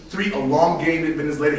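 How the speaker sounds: usual speaking voice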